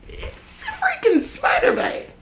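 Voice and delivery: retold friend's high-pitched exclamation; mock high squeal. Friend Kathy quoted, high squeal.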